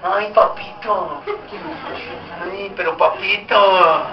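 slow, drawn-out mock pleading; lightly voiced child coaxing a reluctant dad; child nagging father. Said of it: "es triste y es el colmo".

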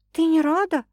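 rising, surprised question. A narrator's reading. Sasha asks her sister, surprised. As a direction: ask it in surprise. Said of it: "удивилась Саша".

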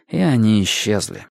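ordinary delivery

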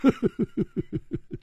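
breathy hearty laugh before speaking. Acted. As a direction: laugh it off, friendly but probing. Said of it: "他笑着大声说".

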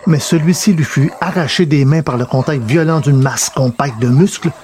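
plain reading voice

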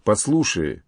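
ordinary delivery, nothing marked

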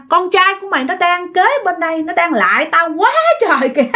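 high, bitter spirit voice; accusing vengeful ghost. Acted. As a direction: hurl the old wrongs back at her. Ghost accuses mother-in-law. (giọng vong oán hận, cao, buộc tội mẹ chồng)

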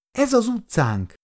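put-on high child's exclamation; excited little girl. A narrator's reading. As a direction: announce it as a thrilled small girl. Child excitedly recognizes her street. (izgatott kislányos hang)

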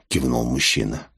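ordinary delivery, nothing marked

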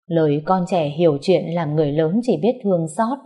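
ordinary delivery, nothing marked

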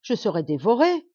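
frightened queen crying out; terrified cry. A narrator's reading. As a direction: wail it out in fear of being eaten. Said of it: "s'écria-t-elle douloureusement".

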